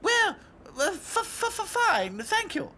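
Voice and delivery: high, stuttering scared voice; stammering in fear. A narrator's reading. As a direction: stammer out a terrified reply.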